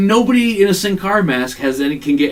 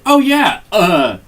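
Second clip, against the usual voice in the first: high, louder hammed-up groan; imitating Hunter's sell; 5 semitones higher, 6 dB louder, much slower than usual. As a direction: overplay the fake hit. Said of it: "Hunter's like".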